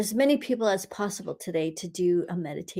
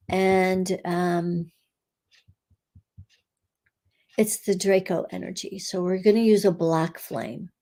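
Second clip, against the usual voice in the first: guided meditation. Acted. Healer guiding listeners through a meditation, calm and steady. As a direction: lead the group gently through the energy work. Leads a curse-clearing meditation.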